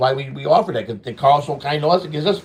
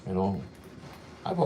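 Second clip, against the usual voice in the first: ordinary delivery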